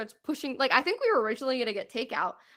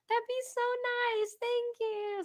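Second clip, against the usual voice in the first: much higher, squeaky grateful gush; gushing thanks; 13 semitones higher than usual. Retold or imitated. Tourists to bar lady, high gushing thanks. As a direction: thank her like she made your night.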